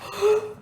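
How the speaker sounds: a sharp, high gasp of fright; startled gasp. Acted. Maid on the stairs startled by Simone, sharp high gasp. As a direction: catch your breath in surprise.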